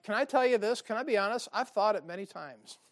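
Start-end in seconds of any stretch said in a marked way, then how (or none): none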